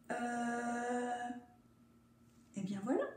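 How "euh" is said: soft unsure "Euh?"; a quiet puzzled hesitation; hesitant uh. Shown as a demonstration. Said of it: "Et elle fait". (« Euh ? » hésitant et doux)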